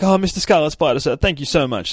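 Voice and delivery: high, loud grovelling thanks; fawning weasel. Retold or imitated. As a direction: suck up to the hero like a weasel. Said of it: "says the fucking weasel".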